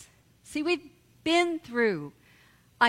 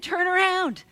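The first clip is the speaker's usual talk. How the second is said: put-on insistent child; 7 semitones higher, 4 dB louder than usual. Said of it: "And Max insisted".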